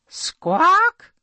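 high squawk from the princess; bird squawk. Acted. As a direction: imitate a bird's cry, loud and high.

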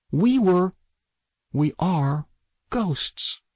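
high, halting insistence; distressed revelation. A narrator's reading. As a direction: force the words out, pitched high, pausing between. Girl insists haltingly they are ghosts.

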